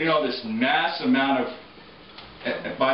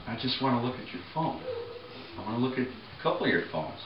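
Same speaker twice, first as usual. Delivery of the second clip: softer, lower mock-meek customer; meek request; 3 semitones lower, 8 dB quieter, faster than usual. Customer asking a store clerk to see phones, meek request. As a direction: ask quietly, keeping the voice down.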